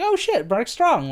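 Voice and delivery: pleasant surprise. Retold or imitated. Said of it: "So I was like".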